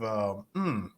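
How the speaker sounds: ordinary talk, nothing marked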